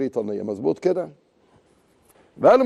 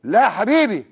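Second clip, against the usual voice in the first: ordinary talk, nothing marked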